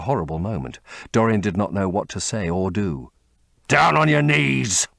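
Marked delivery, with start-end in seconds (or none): 3.7-4.9 louder, slow growling order; growled command. A narrator's reading. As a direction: force him down with a growl. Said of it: "growled the man".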